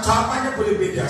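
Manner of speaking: usual speaking voice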